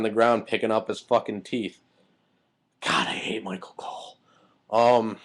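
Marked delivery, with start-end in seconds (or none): 2.8-4.2 whispered under the breath, exasperated; hushed, voiceless venting. Real, not acted. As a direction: seethe it in a low whisper. Venting hatred under his breath.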